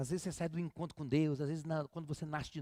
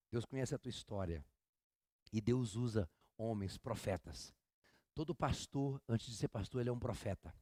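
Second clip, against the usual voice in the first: ordinary talk, nothing marked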